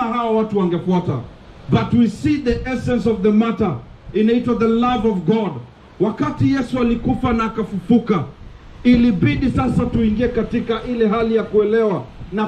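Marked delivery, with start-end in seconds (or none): none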